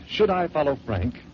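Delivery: ordinary delivery, nothing marked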